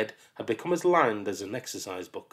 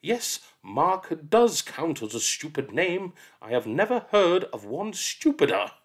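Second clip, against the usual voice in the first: mock-stern aside; 7 semitones higher, 3 dB louder than usual.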